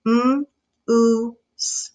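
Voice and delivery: segmented slowly, one sound at a time; M, oo, s, each sound apart. Shown as a demonstration. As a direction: break moose into its separate sounds. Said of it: "then I say it sound by sound".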